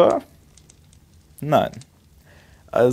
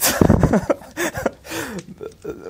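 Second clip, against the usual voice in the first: high burst of real laughter. Real, not acted. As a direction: crack up at your own joke. Laughs at own comparison.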